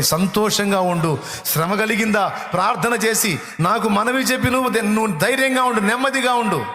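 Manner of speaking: impassioned preacher voicing God's comfort; preaching at full force. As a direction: build from firm reassurance to a ringing, high call. Preaches God's promise, rising.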